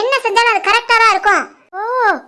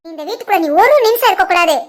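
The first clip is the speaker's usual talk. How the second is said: ordinary talk, nothing marked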